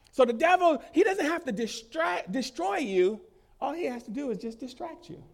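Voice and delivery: high, loud sermon delivery; preaching at full force. Acted. Preacher warning the church about the devil, high loud preaching. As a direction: hammer the warning home like a pulpit preacher.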